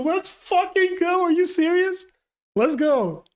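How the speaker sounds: slow, elated shriek at the reveal; ecstatic cheer. Real, not acted. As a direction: scream it like your team just won. Cheering Luffy's crew invitation.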